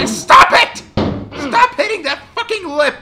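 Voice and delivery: high furious shout; angry yell. Real, not acted. Streamer yelling at the hammer catching the ledge. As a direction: yell at the game to stop.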